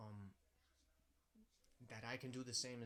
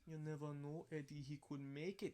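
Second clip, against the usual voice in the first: put-on hopeful Crucian accent, rising pitch; 4 semitones higher than usual. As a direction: imitate the uncle's Crucian accent. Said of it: "in his Crujan accent".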